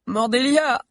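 high, drawn-out crying call; children's wailing. A narrator's reading. Hungry children calling their leader, wailing. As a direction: wail the name like a hungry child. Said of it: "pleuraient plusieurs enfants".

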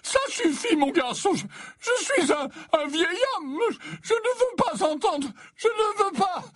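put-on very high, pleading old man; anguished, halting high-pitched protest; distressed outcry. A narrator's reading. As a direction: beg him to stop, stammering in a high voice. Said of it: "s'écria soudain Slughorn en levant une main tremblante".

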